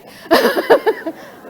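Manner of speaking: short amused laugh at her own joke; real laugh. Real, not acted.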